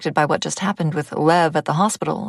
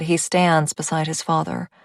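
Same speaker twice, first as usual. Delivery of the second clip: plain reading voice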